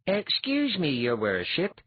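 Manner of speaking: high, polite address to a holy man; mock-polite request. A narrator's reading. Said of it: "Eliar said politely".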